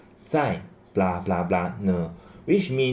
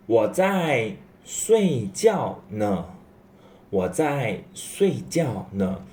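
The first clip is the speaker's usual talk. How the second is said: slow model reading; slower than usual. Shown as a demonstration. Teacher reading example sentence.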